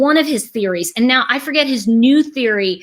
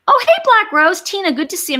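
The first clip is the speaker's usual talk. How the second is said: excited greeting; 10 semitones higher, 4 dB louder than usual. Real, not acted. Greeting chat viewer.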